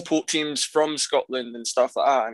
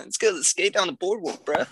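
higher-pitched American bro voice; American skater accent; 5 semitones higher than usual. Shown as a demonstration. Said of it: "Do you guys do American accents?".

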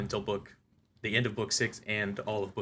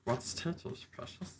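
soft, strangled little creature voice; badly faked creature impression, trailing off very quiet; Gollum gone Stitch; 3 semitones higher, 11 dB quieter than usual. Answering co-host's movie quote, botched Gollum sounding like Stitch. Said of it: "that was more of a Stitch".

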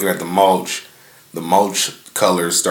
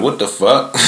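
higher, shocked retold outburst; startled panic; 5 semitones higher than usual. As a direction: replay the sudden fright of the moment. Retold panic during meditation.

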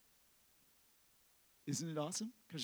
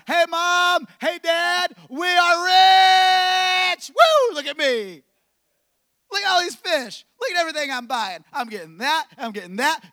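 loud, higher, gleeful boast; giddy hammed-up celebration; excited bragging; 9 semitones higher, 12 dB louder than usual. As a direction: show off to Mom and Dad. Fishermen boasting rich.

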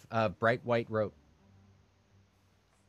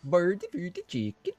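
Swedish chef voice; 8 semitones higher, slower than usual. Retold or imitated. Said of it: "Swedish chef from the Muppets".

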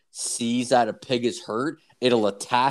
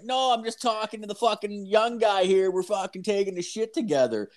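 mock boss; 9 semitones higher than usual. Boss answering coworker, voiced.

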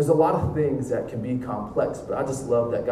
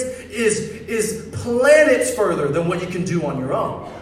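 high, loud, driving sermon delivery; impassioned preaching; 7 semitones higher, 7 dB louder than usual. Real, not acted. Preacher urging the crowd to give heart and soul, impassioned. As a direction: press each point home to the congregation.

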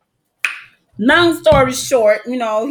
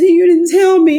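louder, higher complaint; accusing yell; 7 semitones higher, 8 dB louder than usual. Retold or imitated. Daughter yelling at her mom over the phone. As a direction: blame mom at full volume. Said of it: "I said".

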